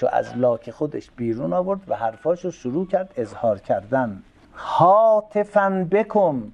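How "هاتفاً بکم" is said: calling out. Shown as a demonstration. Calling out, word means calling.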